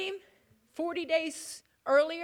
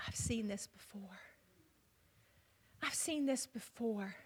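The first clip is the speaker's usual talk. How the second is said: hushed whisper; 5 semitones lower, 10 dB quieter than usual. Acted. Disciples recognize Jesus, hushed.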